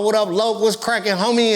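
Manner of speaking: West Coast greeting. Retold or imitated. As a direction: greet like an LA homie. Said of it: "I'm a West Coast rapper".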